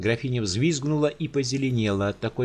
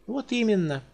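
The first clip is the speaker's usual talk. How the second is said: lighter, higher and breezy; a careless noblewoman chattering; carefree countess; 11 semitones higher than usual. Acted. Countess casually explains her gift to the giant.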